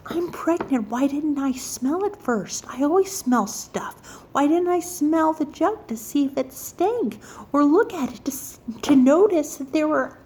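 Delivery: high, flustered self-questioning; retold freaking out. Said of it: "I'm freaking out".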